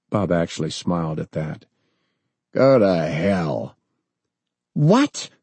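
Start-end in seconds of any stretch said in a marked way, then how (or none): 2.5-3.8 defiant. Acted. Defiant reply to the president. 4.7-5.3 high shocked shriek; sharp high outraged cry; shrieking what. Acted. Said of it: "Her voice was almost a shriek".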